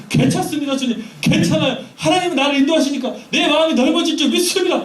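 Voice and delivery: high, loud confident prayer. Retold or imitated. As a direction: declare it out loud to the Lord. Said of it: "기도해봐요". (높고 크게 확신하는 기도)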